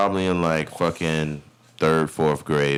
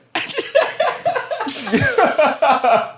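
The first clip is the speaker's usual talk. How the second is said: high laugh burst; 3 dB louder than usual. Real, not acted. Laughs at the teacher reveal.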